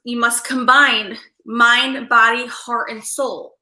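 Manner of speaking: slow, deliberate listing; mind, body, heart, soul, one by one; slow emphasis. Real, not acted. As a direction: spell out each word slowly. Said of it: "Let me add again".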